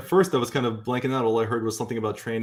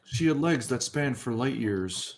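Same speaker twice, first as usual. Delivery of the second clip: lightly voiced slow noir narrator; a hint of a hardboiled detective, slow; 2 semitones higher, 3 dB quieter, slower than usual. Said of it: "You like that".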